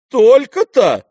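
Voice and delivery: very high, loud exclamation; loud frank surprise. A narrator's reading. Aglaya hears the prince's fortune, loudly surprised. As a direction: burst out in open astonishment. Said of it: "громко и откровенно удивилась Аглая".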